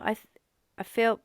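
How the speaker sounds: usual speaking voice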